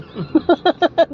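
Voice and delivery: high, loud burst of giggles; laughing. Real, not acted. Laughs about eager Babuji. (ऊँची, खिलखिलाती हँसी)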